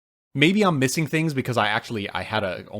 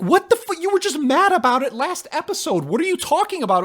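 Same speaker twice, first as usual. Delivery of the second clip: high, loud disbelieving outburst; exasperated outburst; 4 dB louder than usual. Retold or imitated. Fan yelling at the show in disbelief, high and loud. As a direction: blurt it out in shocked disbelief. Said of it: "I'm like".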